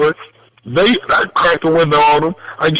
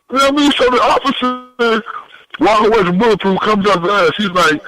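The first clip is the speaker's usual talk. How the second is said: ordinary talk, nothing marked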